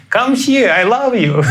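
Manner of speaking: hammed-up beckoning, easing down at the end; imitated yelling. Mimicking the radio lady calling someone over, high mock yell. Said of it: "started yelling on the top of her lungs".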